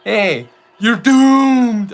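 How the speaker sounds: very slow, drawn-out ominous doom warning; slow ominous raspy drawl. Retold or imitated. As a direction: stretch out the doomed warning, very slowly. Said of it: "that's a voice that would be used in South Park".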